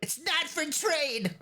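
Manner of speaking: put-on very high, slow child's voice; guard your toy like a wary small boy; sulky little boy. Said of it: "The boy is like".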